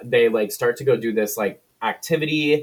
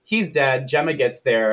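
ordinary talk, nothing marked

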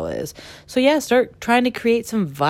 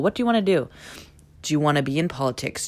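ordinary talk, nothing marked

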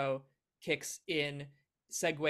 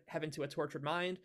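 ordinary talk, nothing marked

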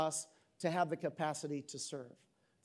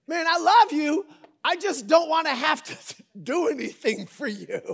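mock reluctance; 7 semitones higher, 6 dB louder than usual. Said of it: "voicing reluctant helper".

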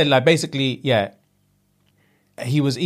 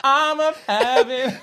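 after laughing hard; slower than usual. Real, not acted. Laughing hard mid-sentence.

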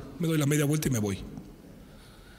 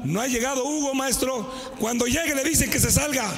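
classmates shouting; 7 semitones higher than usual. Retold or imitated. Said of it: "Y le gritaban".

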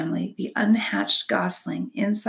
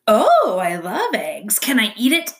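a hungry fox begging; greedy fox; 3 semitones higher, 6 dB louder than usual. A narrator's reading. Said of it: "said Fink".

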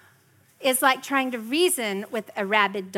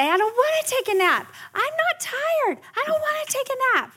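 much higher mock toddler whine; whiny preschooler; 3 dB louder than usual. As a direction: whine like a tired little kid.

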